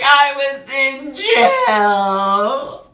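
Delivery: high, slow retold sobbing with gasp. Confessing to her friend on the phone, crying. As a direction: choke out the news through tears. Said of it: "Crying again, hysterically".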